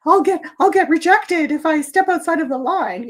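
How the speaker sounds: hammed-up fear of leaving the pack; mock fearful. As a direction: squeal it like a frightened follower. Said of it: "they're like".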